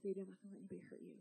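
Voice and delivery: put-on soft, fast hush to a baby; tender comfort. Stranger comforting an abandoned baby, soft and tender.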